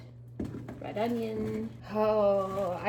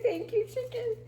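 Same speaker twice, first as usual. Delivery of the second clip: much higher, wavering "Thank you, chicken."; high tearful thanks. Real, not acted. Thanking the chicken for its life, voice high and tearful. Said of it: "I'll just say".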